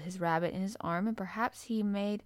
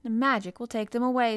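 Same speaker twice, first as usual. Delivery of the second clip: solemn priestly pronouncement, a bit raised; high priest voice; 4 semitones higher, 6 dB louder than usual. Acted. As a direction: speak like a young high priest. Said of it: "in a high priest voice".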